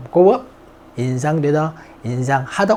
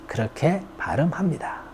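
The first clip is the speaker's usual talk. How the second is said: whisper; 8 dB quieter than usual. Real, not acted. Aside after a pronunciation demo.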